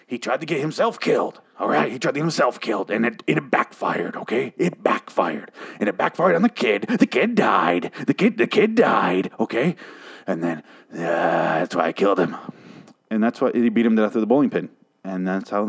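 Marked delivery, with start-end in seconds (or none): 0.1-9.8 strained, hammed-up film-villain confession; over-the-top oilman impression; Daniel Plainview. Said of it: "doing that Daniel Plainview voice". 11.0-12.3 low strained movie-villain voice; hammed-up oilman impression; Daniel Plainview. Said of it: "doing that Daniel Plainview voice".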